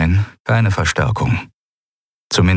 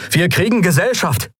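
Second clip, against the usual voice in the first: calling out; 10 semitones higher than usual. Acted. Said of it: "rief Kai".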